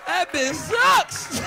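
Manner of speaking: drawn-out punchline. Retold or imitated. Punchline about the turtle.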